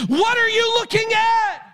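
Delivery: preacher challenging the listeners; challenging shout. Acted. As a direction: confront the congregation. Altar call challenge.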